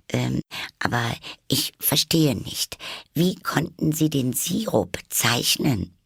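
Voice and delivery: high, slow, hesitant question; very cautious. A narrator's reading. Alice avoids offending the Dormouse, cautious and slow. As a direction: feel your way carefully in a high voice. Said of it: "fing daher sehr vorsichtig an".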